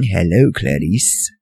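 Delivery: low, soft, slow creepy Hello, Clarice; sinister whisper-soft lightly voiced killer; creepy Hannibal. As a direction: ooze the name like Hannibal Lecter. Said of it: "remember him saying".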